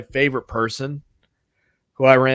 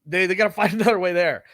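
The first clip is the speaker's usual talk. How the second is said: much higher, louder and rushed; hurry, ready to abandon him; panicked driver; 6 semitones higher, 3 dB louder, much faster than usual. Retold or imitated. Driver wanting to leave the late passenger, higher and rushed. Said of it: "And he's like".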